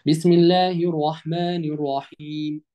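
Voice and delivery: slow, level-toned tajweed chant; measured, drawn-out model recitation; Quran recitation. Shown as a demonstration. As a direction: recite with long vowels and hummed nn. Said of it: "هقرأ السورة مرة تانية".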